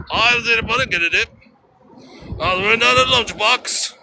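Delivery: high, garbled Manchester gibberish; mock Manchester accent. Cab driver giving directions to baffled American, mock Manchester accent. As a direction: babble like an incomprehensible Manchester cabbie. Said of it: "maybe it was a Manchester accent".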